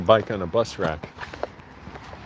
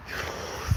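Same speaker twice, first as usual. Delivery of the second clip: soft, breathy out-breath letting tension go; long release sigh; 8 dB quieter than usual. Shown as a demonstration. Letting go of tension while walking, a deep sigh. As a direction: sigh it all out, slow. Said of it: "Everybody take a moment".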